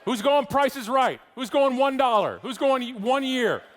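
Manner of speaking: loud, high shout to the crowd; calling out. Real, not acted. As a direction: holler the questions out across the room. Calling out to audience for guesses.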